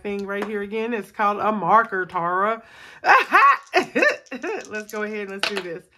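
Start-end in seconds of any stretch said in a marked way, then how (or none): 2.8-4.6 high laugh. Real, not acted. Laughing at own joke.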